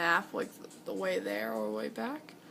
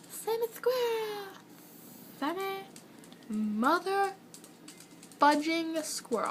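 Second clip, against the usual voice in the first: mock exclamation; 10 semitones higher, slower than usual. Playful fake curse outburst.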